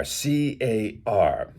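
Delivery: ordinary talk, nothing marked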